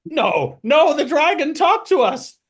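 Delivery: high, loud squeaky kobold protest; insistent kobolds. Acted. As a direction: protest it like stubborn little kobolds. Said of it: "the other ones inside of the mech are like".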